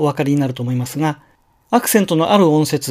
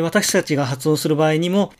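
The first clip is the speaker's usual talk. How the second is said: normal talking voice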